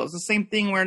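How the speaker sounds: usual speaking voice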